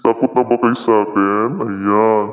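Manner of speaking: monster filter asking if they're scared; monster voice effect. Shown as a demonstration. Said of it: "Di ba, monster yung boses mo?".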